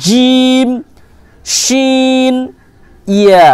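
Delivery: mid-tongue letters জিম শিন ইয়া; jim shin ya demo. Shown as a demonstration. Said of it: "এই তিনটি হরফ উচ্চারিত হয়". (জিভের মাঝখান থেকে জিম শিন ইয়া)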